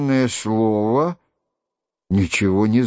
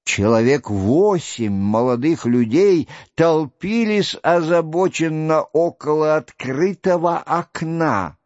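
usual speaking voice

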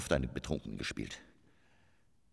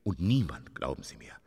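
grandiose storytelling; 9 semitones higher, 6 dB louder than usual. Acted. Grand anecdote, loud.

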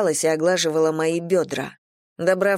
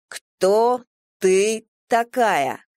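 stern demand; 3 semitones higher, much slower than usual. A narrator's reading. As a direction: press hard on кто. Prince demands name. (строго и медленно)

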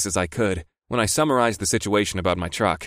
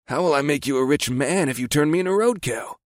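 higher, mock-innocent question; exaggerated innocence, pitched up; most innocent voice; 5 semitones higher than usual. A narrator's reading. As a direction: play innocent with your pitch raised. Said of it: "in his most innocent voice".

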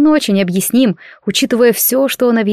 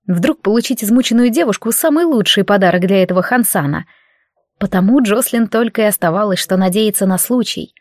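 plain reading voice

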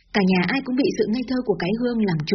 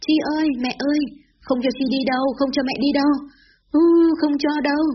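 crying and wailing; 7 semitones higher than usual. Acted. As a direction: wail after the departing motorbike through tears. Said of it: "vừa khóc vừa gào theo".

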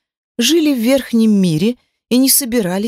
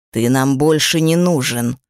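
low demon growl; 6 semitones lower than usual. A narrator's reading. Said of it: "прорычал Кайтиф". (рычащий голос демона)

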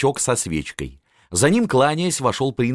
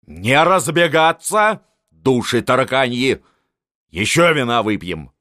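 loud high barking command; old marshal ordering guests back down; barked order; 5 semitones higher, 3 dB louder than usual. A narrator's reading. (громко, властно приказать)

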